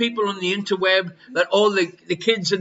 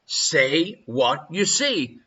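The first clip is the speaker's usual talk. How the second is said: catchphrase delivery; 5 semitones lower, much slower than usual. Shown as a demonstration. Quiz host echoing a game show catchphrase, slow and low. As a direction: drop into the telly catchphrase. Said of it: "and say what you see".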